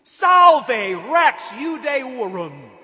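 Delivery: loud, high, drawn-out mock salute; big ringing shout of false homage; mocking hail. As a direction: shout it slowly like jeering soldiers. Soldiers mocking Jesus with a salute.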